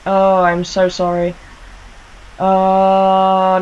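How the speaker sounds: ordinary talk, nothing marked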